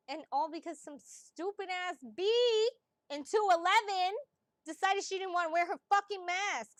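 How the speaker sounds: loud, high, indignant outburst; angry rant. Real, not acted. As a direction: rant in real exasperation. Ranting about neighbour without mask.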